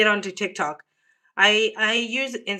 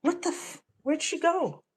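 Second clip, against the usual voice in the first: puzzled; 8 semitones higher, 6 dB quieter than usual. Real, not acted. Looking for cat outside.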